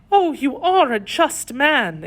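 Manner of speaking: high, drawn-out cry of admiration; impassioned lady's outcry; fervent exclamation. A narrator's reading. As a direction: exclaim it slowly in a high, pleading woman's voice. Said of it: "exclaimed her ladyship".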